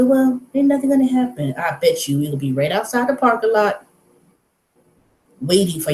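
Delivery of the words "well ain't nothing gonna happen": dismissive HR. Retold or imitated. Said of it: "they gonna say".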